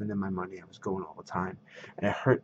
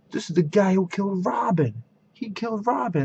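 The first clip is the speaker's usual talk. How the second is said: high, louder, excited announcement; excited accusing outcry; 11 semitones higher, 5 dB louder than usual. Retold or imitated. Shopkeeper telling everyone who this Batman writer is, excited. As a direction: imitate someone excitedly pointing out a culprit.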